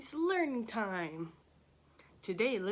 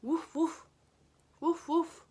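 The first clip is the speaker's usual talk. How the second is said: playful woof woof, higher; dog bark imitation; 7 semitones higher, 4 dB louder than usual. Shown as a demonstration. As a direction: bark like a cartoon puppy. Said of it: "dog cries".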